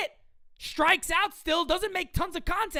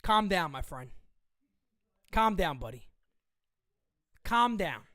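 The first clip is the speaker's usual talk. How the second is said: lower, softer calm down to a friend; mock soothing; 9 semitones lower, 5 dB quieter than usual. Host calming an upset viewer, mock soothing and low. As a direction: settle him down, half joking.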